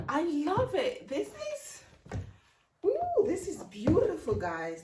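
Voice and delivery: excited squeal at new boots; thrilled gush. Real, not acted. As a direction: gush with real delight, pitch flying up. Delighted at new shoes.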